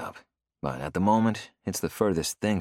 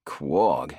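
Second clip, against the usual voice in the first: Quogue said lower, a grumbling question; 4 semitones lower than usual. Acted. O'Doul grumbles at the town name Quogue. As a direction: grumble the word Quogue back. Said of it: "O'Doul grumbles".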